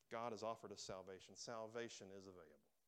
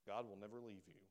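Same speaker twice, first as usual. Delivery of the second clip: usual speaking voice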